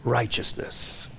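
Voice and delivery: righteousness whispered softly and slow; a hushed, reverent righteousness; whispered word. Real, not acted. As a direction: breathe righteousness out as a hush. Word dropped to a hush.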